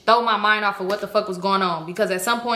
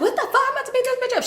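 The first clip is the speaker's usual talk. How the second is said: very high squeaky imitation; mocking girl's voice; 14 semitones higher, much faster than usual. Other girl getting up to fight, mocked in high pitch. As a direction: mimic an outraged girl way up high.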